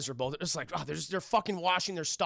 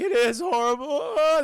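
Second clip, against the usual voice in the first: loud, high mock wail; hammed-up bawling; mock crying; 8 semitones higher, 9 dB louder than usual. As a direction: imitate kids bursting into tears. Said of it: "they start crying".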